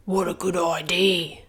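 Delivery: lightly voiced young dragon; a grateful dragon, lightly voiced; Zog. Zog answers.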